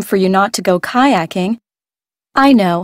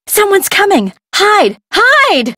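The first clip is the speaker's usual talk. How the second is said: alarmed shouts, much higher; urgent alarm; 10 semitones higher, slower than usual. Acted. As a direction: cry out to hide, frightened. Alarm as captors approach.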